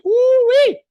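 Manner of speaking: very high, loud hammed-up whoop; over-the-top woo-wee; mock whoop. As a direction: whoop like a cheering fan. Mock cheer.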